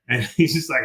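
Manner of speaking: normal talking voice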